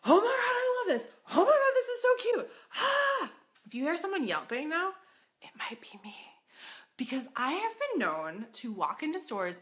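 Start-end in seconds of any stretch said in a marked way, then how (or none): 0.1-3.3 high, loud excited yelp; yelping excitement. Retold or imitated. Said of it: "hear someone yelping out". 5.4-6.2 whispered confession; whispered aside. Acted. Confessing aside after yelping.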